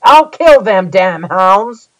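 slow, loud enraged threat; loud furious threat. Acted. Reuben shouting as he grabs the axe for the dogs. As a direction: bellow it out, drawn out and furious. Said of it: "said in a loud voice".

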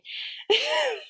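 quick high giggle, falling; short high laugh that drops. Real, not acted. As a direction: laugh at how it went. Laughing after a quote.

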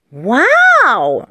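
loud, drawn-out mock-impressed wow; praising wow. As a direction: praise him with a show of awe. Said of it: "lobt Tante Rotz". (Tante Rotz lobt gespielt beeindruckt)

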